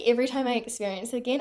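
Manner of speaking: ordinary talk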